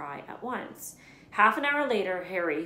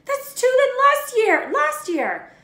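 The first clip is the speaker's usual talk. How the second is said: put-on high, loud whining child; petulant kid whining about his presents; whiny spoilt boy; 14 dB louder, slower than usual. A narrator's reading. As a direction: complain like a spoilt brat. Dudley complaining, voiced high.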